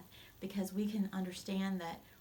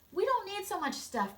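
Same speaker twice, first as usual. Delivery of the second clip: put-on higher, louder kids' voices; eager children; 11 semitones higher, 5 dB louder than usual. The children telling their parents, high and eager. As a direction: chirp it like excited children.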